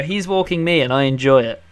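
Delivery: loud, proud retort; emphatic comeback. Retold or imitated. Dog owner answering teasing passers-by, loud mock-proud retort. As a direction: belt out the reply over the teasing. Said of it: "And I go".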